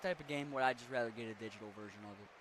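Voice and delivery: usual speaking voice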